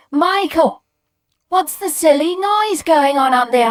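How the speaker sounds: very high, loud, indignant shout; mum calling upstairs. Retold or imitated. Mum from downstairs to noisy Michael, shouted demand. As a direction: holler a question up the stairs. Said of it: "hear my mum from downstairs going".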